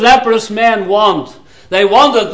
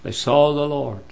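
low, hushed sermon with long pauses; solemn preaching; 8 semitones lower, 14 dB quieter than usual. Real, not acted. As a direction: drop low and soft, warn the congregation gravely. Preacher drops to solemn warning.

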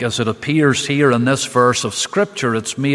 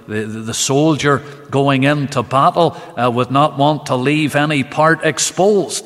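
usual speaking voice

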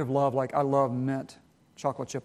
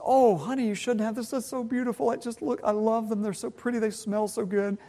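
imitating gushing wife; 7 semitones higher than usual. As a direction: play the delighted wife getting flowers, pitched up. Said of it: "and she says".

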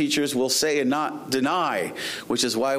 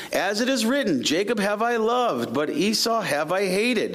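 raised, declamatory Bible reading; scripture reading; 8 semitones higher than usual. Acted. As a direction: proclaim the verse to the congregation, drawing out key words. Reads scripture aloud.

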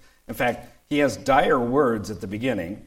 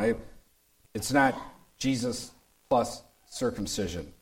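ordinary talk

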